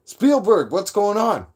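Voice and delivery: high, hammed-up holler to a big shot; mock calling out. Streamer jokingly hailing a film director, high playful call. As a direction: call out as if owed a part.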